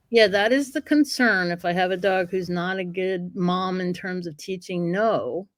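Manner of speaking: ordinary talk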